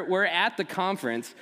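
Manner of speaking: usual speaking voice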